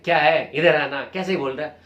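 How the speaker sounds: loud, irritated snapping at someone; irritating tone. Retold or imitated. Coach shows an irritated boss calling a junior rudely, loud and harsh. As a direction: bark it like an annoyed boss. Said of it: "बड़ा irritating tone में बात करते हैं".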